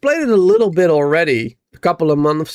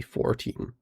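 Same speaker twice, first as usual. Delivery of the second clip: soft, unvoiced muttering to self; whispered mutter; 12 dB quieter than usual. Real, not acted. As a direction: whisper it under your breath. Muttering to self while setting up.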